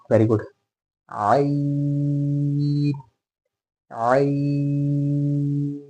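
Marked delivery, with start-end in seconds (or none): 1.1-5.8 "आइन" stretched long from the throat; drawn-out ain. Shown as a demonstration. Quran teacher modelling the letter for students. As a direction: hold "आइन" slowly as a model. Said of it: "शुरू आइन से".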